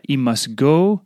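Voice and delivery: ordinary talk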